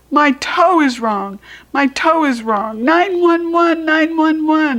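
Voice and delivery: high, loud panicked yell; a kid demanding nine one one. Acted. Junie B yelling to mother about her toe. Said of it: "I yelled".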